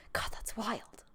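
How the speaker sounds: hushed, unvoiced whisper to oneself; whispered self-quote. Host quoting herself saying her new catchphrase, whispered. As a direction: whisper it under your breath.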